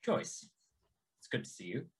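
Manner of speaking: low, soft character voice; gruff old warrior greeting. Acted. Zur approaches Joyce, low soft in-character greeting. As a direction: drop the voice low and quiet for a measured greeting. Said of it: "Joyce and Jannar and goes".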